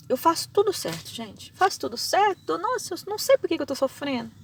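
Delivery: high, loud whiny complaint; a loud, high moan about suffering for nothing; self-pitying complainer. Retold or imitated. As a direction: play the believer who thinks she does everything right. Imitating a self-pitying believer.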